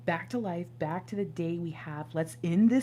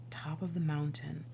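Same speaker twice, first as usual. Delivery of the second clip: whispered; 2 semitones lower, 6 dB quieter than usual. Real, not acted. Reading card lyrics.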